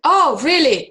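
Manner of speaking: mock surprise. Teasing mock surprise at co-host.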